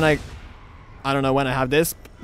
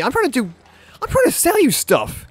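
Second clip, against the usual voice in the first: shopkeeper voice; 10 semitones higher, 4 dB louder than usual. Retold or imitated.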